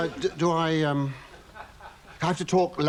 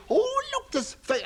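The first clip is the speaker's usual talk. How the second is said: mock passer-by. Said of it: "they say to me".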